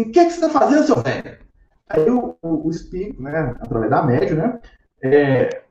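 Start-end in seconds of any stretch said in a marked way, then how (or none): 0.0-1.4 high, indignant demand dropping at the end; aggressive spirit. Acted. Spirit confronting the silent old doctor, acted aggressive demand. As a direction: demand it scornfully, pitched up. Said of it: "muito agressiva".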